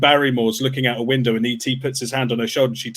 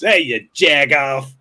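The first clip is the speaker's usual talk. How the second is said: mock Boston tough; 3 dB louder, slower than usual. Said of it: "And they're like".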